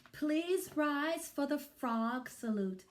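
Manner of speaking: high teacher announcement; silly teacher voice. Acted. Teacher voice in read-aloud.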